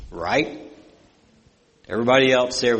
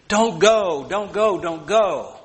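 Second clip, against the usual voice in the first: rising high, pleading don't go again and again; cling to him with the words; desperate begging; 6 semitones higher, slower than usual. Retold or imitated. Mother begging John not to leave, high desperate plea. Mother begs son to stay.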